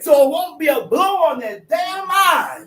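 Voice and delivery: fervent preaching shout. Real, not acted. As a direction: drive it home from the pulpit.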